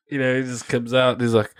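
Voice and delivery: normal talking voice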